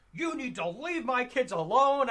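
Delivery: high, loud mocking mom voice; angry mother. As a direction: imitate her telling him off. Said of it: "She's like".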